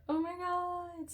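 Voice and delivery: high, breathy-free pitch jump of delight; oh my god, high and amazed; surprised gasp. Real, not acted. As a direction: let it burst out, genuinely struck. Card reveal surprises reader.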